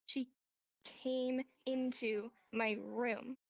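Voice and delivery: slow, each word spelled out separately; teacher showing the tedious word-for-word way, slow and deliberate. Shown as a demonstration. Teacher demonstrating the slow word-for-word version. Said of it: "See how I'm going through every single word?".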